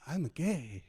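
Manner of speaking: put-on quoting the Tokyo audience member in a mild Tokyo accent. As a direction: do his Tokyo accent, understated. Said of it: "exactly what he sounded like".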